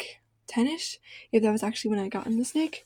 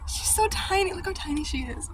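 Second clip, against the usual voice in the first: overwhelmed by cuteness; 8 semitones higher than usual. Real, not acted. Gushing over tiny snake.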